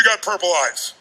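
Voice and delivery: ordinary delivery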